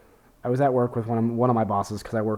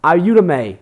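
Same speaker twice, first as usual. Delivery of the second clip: loud, higher sneering caricature of a rude man; 6 semitones higher, 10 dB louder than usual. Retold or imitated. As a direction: ham up an ignorant customer demanding help. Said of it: "the only way he could be more racist by saying something like that would be like".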